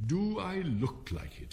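mildly sad. A narrator's reading. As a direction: ask slowly with a touch of sorrow. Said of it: "shook his head sadly".

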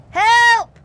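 high, loud panicked cry; cry for help. Acted. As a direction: yell it as the list flies off. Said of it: "cried Toad".

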